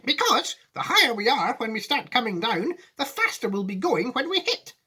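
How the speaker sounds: high, animated explanation; Mister Wonka. Acted. Wonka justifying the climb to passengers, high and animated. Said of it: "said Mister Wonka".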